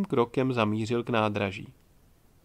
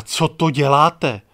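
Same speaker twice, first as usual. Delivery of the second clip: much louder, slow indignant hiss; angry hiss; 8 dB louder, slower than usual. A narrator's reading. Inka furious at Čmelda over the crying baby, loud hiss. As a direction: let the fury out in each word. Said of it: "zasyčela Inka".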